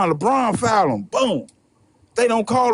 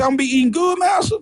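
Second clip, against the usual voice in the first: pitched way up, rising in disbelief; a naive man thrilled by the promise; eager slave; 5 semitones higher than usual. Retold or imitated. Slave asking master hopefully, voice jumping high. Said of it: "He said".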